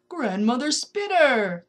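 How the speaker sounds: loud, slow growling greeting; rumbling growl. A narrator's reading. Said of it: "the voice rumbled in a voice like a growl".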